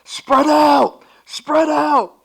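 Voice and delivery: loud sideline yell, pitched well up; coach yelling. Retold or imitated. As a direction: bellow it across the court to scattered kids. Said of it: "I'm yelling out".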